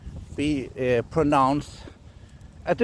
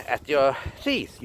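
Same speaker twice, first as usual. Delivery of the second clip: ordinary talk, nothing marked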